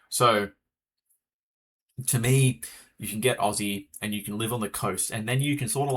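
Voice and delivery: normal talking voice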